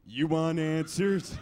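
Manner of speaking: slow, loud, challenging delivery; hammed-up courtroom showdown; movie-quote impression. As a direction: fire it back as a dramatic demand.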